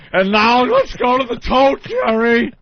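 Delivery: raspy, cracking announcer voice; old announcer, voice breaking. Retold or imitated. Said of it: "his voice is cracking".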